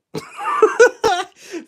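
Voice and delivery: high, loud burst of laughter; a sudden high laughing fit. Real, not acted. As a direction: let a big laugh burst out. Laughing at own joke.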